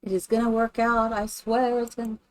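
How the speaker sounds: ordinary delivery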